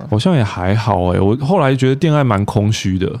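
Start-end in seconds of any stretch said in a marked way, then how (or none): none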